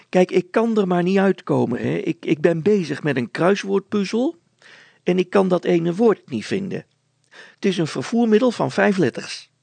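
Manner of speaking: high, loud woman's voice; fussy wife. Acted. Wife stuck on a crossword asks for help, high and loud.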